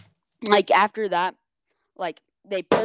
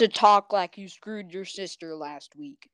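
mocking Southern accent, louder and slower; 7 dB louder than usual. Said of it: "talk with your southern accent".

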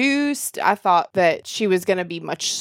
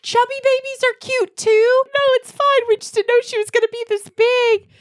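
much higher, louder flustered nurse voice; 3 dB louder than usual. Retold or imitated. Nurse freaking out over the chubby baby, high flustered squeak. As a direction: squeak out panicky reassurance. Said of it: "freaking out".

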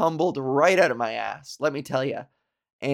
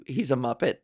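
normal talking voice

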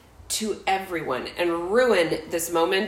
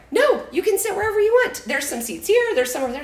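higher, fast helpful host; hurried host; 11 semitones higher, faster than usual. Retold or imitated. Retelling how she answered the guests, higher and hurried. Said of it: "So then I was like".

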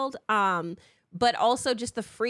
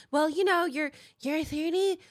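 high-pitched mock know-it-all; nagging busybody; 7 semitones higher than usual. Mimicking someone who nags her about her age, nagging. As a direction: put on a higher, fussy voice.

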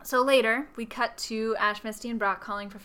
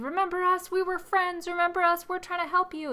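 high-pitched mock plea to a friend; pleading; 8 semitones higher than usual. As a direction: plead in a held high voice, retelling. Friends plead with controlled Celebi.

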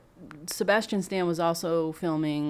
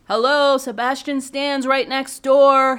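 higher, louder and slow, an obvious-answer "hello"; exasperated hello; 7 semitones higher, 9 dB louder than usual. Real, not acted. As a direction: spell out the obvious to the studio, loud and drawn out. Exasperated complaint about CGI casting.

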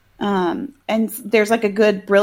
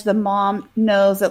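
ordinary delivery

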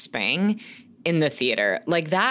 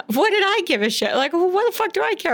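quick, higher, hammed-up scoffing kid; mock kid voice; 13 semitones higher, faster than usual. Mocking her ten-year-old self to the co-host, hammed-up kid scoff. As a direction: play a ten-year-old who doesn't care.

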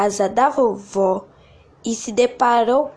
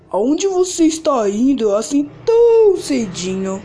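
sly wolf, a bit louder; the cunning wolf probing; 3 semitones higher, 4 dB louder than usual. Acted. Lobo pergunta à menina. (voz de lobo esperto)

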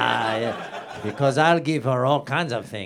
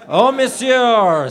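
slow, higher, louder mock-weeping plea; 10 semitones higher, 10 dB louder, much slower than usual. The orphan pleads with the wicked man, mock weeping. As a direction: wail it like a tearful heroine.

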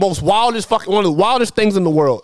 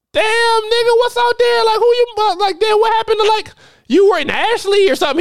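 shocked disbelief; 13 semitones higher than usual. Retold or imitated. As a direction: squeal it like you can't believe your ears. Shocked on hearing he moved.

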